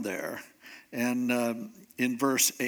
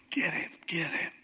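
whispered urging, like soldiers egging on; hushed, voiceless egging-on; urgent whisper; 2 semitones higher, 5 dB quieter than usual. Retold or imitated. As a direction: whisper it as a furtive prompt. Soldiers secretly urging David on.

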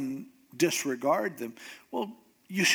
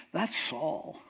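ordinary talk

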